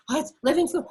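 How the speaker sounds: high, loud dismissive quote, Long Island flavor aside; mock dismissive. Mocking a careless eater, high and loud dismissive voice. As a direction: toss it off like it's nothing special.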